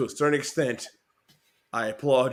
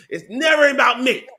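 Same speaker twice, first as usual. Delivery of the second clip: loud whine; 7 semitones higher, 7 dB louder than usual. Acted. Comic complaint.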